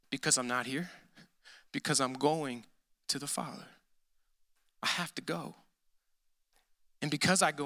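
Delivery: tender and quiet; low and soft. Real, not acted. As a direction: hush it down so they lean in. Sermon, voice dropping low.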